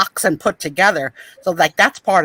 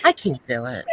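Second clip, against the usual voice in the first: lower, flat giving up; low defeated; 8 semitones lower, 3 dB quieter than usual. Retold or imitated. Angela impression, giving up at a low pitch. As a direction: sink the line down in defeat. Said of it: "so here's my Angela".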